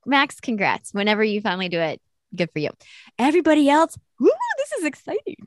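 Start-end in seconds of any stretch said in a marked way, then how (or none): 3.2-5.4 high, squealing woo for the rivals; mock excitement. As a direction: cheer way up high, half joking. Mock cheer at a title race.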